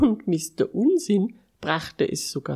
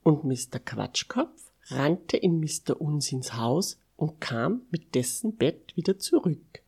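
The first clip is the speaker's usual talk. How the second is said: usual speaking voice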